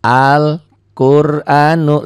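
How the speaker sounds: Al-Qur'anu with the l sounded, slow and loud; careful recitation. Shown as a demonstration. Teacher showing pupils a lam that is read. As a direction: chant Al-Qur'anu, lam clear. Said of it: "lamnya dibaca".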